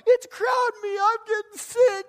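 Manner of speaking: very high, mock crying whine; crying child imitation. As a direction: wail like a little kid starting to cry. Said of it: "They're starting to cry".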